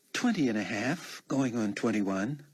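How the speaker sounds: lightly voiced girl playing along; playful reply. She answers the old-man act.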